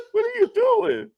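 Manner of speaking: high, slow, incredulous what-are-you-doing, laughing through it; LeBron to J R Smith meme. Retold or imitated. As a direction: squeak out the disbelief like the LeBron meme, cracking up. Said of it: "I had to hit it with the LeBron to J R Smith".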